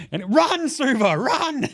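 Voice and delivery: high, loud, slow warning cry; mock urgent yell. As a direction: yell at him to run, half laughing. Telling player to flee.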